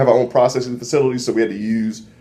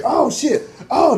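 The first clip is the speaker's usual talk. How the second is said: mock prisoner cries; 14 semitones higher, slower than usual. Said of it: "They're like".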